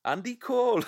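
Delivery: a playful Newcastle voice on Andy Cole; mock Geordie pronunciation; Newcastle accent. Shown as a demonstration. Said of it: "say it in a Newcastle accent".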